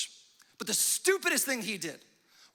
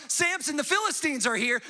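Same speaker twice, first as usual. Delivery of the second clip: high, louder warning call; mock yell; 6 semitones higher, 4 dB louder than usual. Delilah yells to the sleeping Samson, a lightly acted alarm. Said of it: "she yells".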